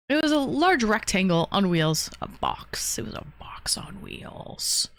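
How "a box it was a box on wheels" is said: whispered aside. Real, not acted. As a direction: whisper it under your breath.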